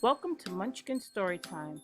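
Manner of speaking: ordinary delivery, nothing marked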